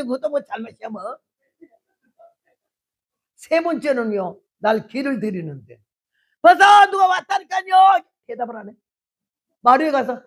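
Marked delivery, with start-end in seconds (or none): 6.4-8.0 high, loud shout through the house; shouting for husband. Retold or imitated. Wife shouting to husband about a visitor, full yell. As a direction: yell that someone came. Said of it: "내 그 제일 작은 톤에 맞춰서".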